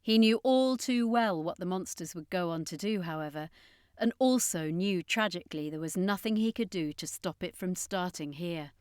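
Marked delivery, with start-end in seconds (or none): none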